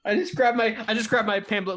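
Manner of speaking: ordinary delivery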